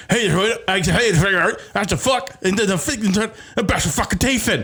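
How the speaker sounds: high-pitched, unintelligible Australian babble; garbled Australian impression. Imitating a Queensland woman in person, garbled high Australian accent. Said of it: "talking to her in person, and it's just like".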